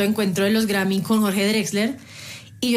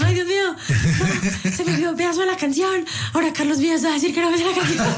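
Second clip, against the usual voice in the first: panicked; 6 semitones higher than usual. Retold or imitated. Panicked inner voice on stage.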